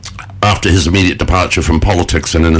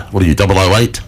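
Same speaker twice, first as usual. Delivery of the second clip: ordinary delivery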